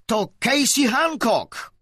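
high, loud, slow shouted accusation; girl shouting out who did it. Acted. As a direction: shout the name out to the room. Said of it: "Wstała i krzyknęła".

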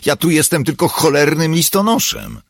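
loud, high, exasperated outburst; indignant protest. A narrator's reading. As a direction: protest angrily that you're not to blame. Accused Paul snaps back in quarrel.

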